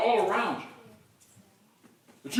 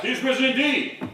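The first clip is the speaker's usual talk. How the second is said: loud, raised answering shout; shouted reply; 6 semitones higher, 6 dB louder than usual. Acted. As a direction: shout it back with full voice. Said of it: "Another shouts back".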